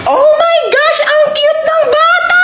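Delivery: put-on high-pitched squeal over the cute child; gushing. Woman meeting the baby, squealing with delight. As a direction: coo at the baby in a very high voice, slowly.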